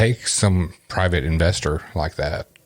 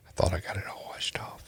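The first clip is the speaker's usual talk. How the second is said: whisper; 12 dB quieter, faster than usual. Pretend. Joking about peeing herself.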